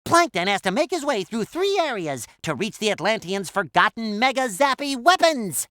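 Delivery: usual speaking voice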